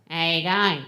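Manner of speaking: Aussie greeting run into one sound; Australian accent. Shown as a demonstration. Mum teaching her son, broad Australian accent. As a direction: drop the consonants, broad Australian. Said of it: "speak with an Australian accent".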